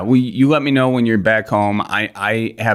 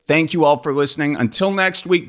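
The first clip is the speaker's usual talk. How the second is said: sign-off announcer; 9 semitones higher than usual. Acted. Show sign-off.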